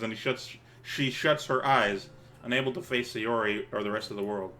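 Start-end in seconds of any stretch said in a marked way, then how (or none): none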